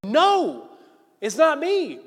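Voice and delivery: high, loud acted protest; emphatic denial. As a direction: deny it outright at full voice. Peter's second, louder denial.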